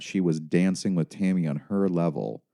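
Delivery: ordinary delivery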